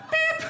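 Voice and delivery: high, squeaky beep like a reversing lorry; high reversing-truck beep. Retold or imitated. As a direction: imitate a truck backing up. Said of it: "like a truck".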